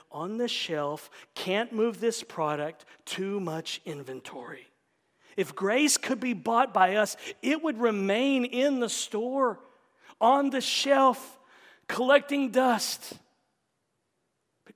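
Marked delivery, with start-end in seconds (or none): 5.4-13.1 loud, high, slow preacher's delivery; emphatic preaching. Real, not acted. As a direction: hammer out each phrase slowly. Preaches at full force.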